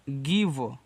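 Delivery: "give" drawn out very slowly with a clear v; pronounce give. Shown as a demonstration. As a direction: stretch "give" slowly for students. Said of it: "ऐसे". ("give" को बहुत धीरे साफ़ बोलो)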